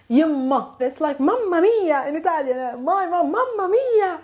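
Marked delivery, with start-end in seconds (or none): 1.2-2.1 high, playful Italian exclamation. Shown as a demonstration. Exclaims Italian version of the phrase. 2.8-4.1 high, amused exclamation; playful exclamation. Shown as a demonstration. Playfully exclaims the Italian equivalent.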